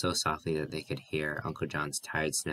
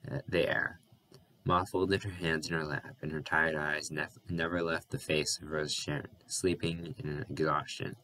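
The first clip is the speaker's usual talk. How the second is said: ordinary delivery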